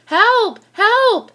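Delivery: high, loud cry for help; shouting for help. A narrator's reading. Policeman calling the firemen on the radio, loud cry for help. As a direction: shout it out, urgent. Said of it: "he said".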